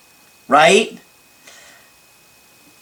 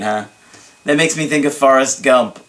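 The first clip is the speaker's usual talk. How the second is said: ordinary talk, nothing marked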